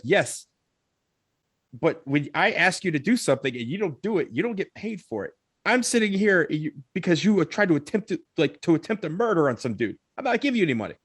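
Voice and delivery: high-pitched, exasperated retort; mock-outraged argument; indignant refusal. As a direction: act out telling him off, pitch climbing. Arguing with driver over payment.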